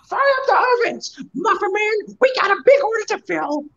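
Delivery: high shouted commands; shouting. Acted. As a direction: shout orders across the kitchen. Shouting orders to bakery.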